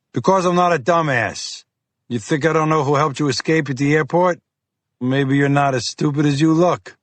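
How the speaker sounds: lightly voiced gruff FBI agent; pushy, impatient Boston cop. Fiore trades insults.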